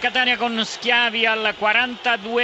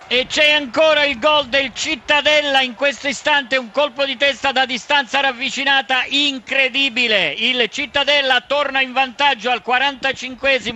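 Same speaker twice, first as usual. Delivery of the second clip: higher, louder breaking news call; excited goal flash; 5 semitones higher, 5 dB louder than usual. Real, not acted. Sudden goal flash from stadium.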